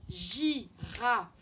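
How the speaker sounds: "Girafe" in separated beats, gi, ra, fe; syllable by syllable. Shown as a demonstration. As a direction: sound out "Girafe" firmly for young learners. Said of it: "J'entends le I dans la première syllabe".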